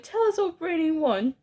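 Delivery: high-pitched, fawning crowd plea; eager cry. A narrator's reading. Witches begging their brainy leader to go on, high eager cry. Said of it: "they cried".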